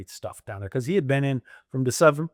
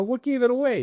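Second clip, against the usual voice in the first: high mock-innocent question; 12 semitones higher than usual. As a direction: play dumb, playfully. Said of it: "I go".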